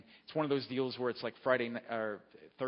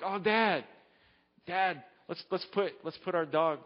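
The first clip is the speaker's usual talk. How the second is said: put-on higher, louder childish begging; pleading kids; 5 semitones higher, 4 dB louder than usual. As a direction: beg Dad like an eager child. Kids begging father for dog hotel.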